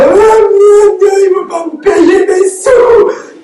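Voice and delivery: high, slow, half-sung lament; high drawn-out mock lament; mock wail. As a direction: ham up a tragic wail over being unpaid. Her wail when police arrive.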